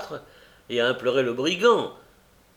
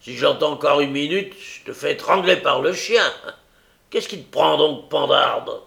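loud, raised voice, hammered out; furious threat; 4 semitones higher, 7 dB louder than usual. Acted. Brigand threatens Nancy with the dog, furious. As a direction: threaten and insult forcefully.

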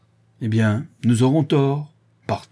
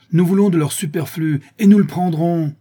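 high, loud declaration of intent; defiant cry; 6 semitones higher, 5 dB louder than usual. A narrator's reading. Wheelwright answers Jean's charge of begging, exclaiming defiantly. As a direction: proclaim it forcefully to rally the men. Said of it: "s'écria le charron".

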